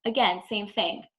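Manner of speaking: ordinary talk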